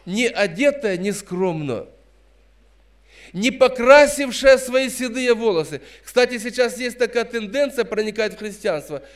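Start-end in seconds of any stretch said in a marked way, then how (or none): none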